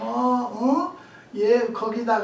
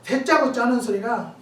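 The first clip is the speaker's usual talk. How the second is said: normal talking voice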